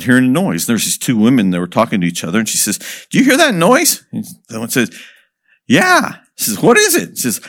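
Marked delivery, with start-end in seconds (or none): 3.1-4.0 much higher, curious woman's voice; village woman. Acted. One woman to another, high and curious. 5.7-6.2 much higher, curious woman's voice; village woman. Acted. One woman to another, high and curious. 6.6-7.2 much higher, curious woman's voice; village woman. Acted. One woman to another, high and curious.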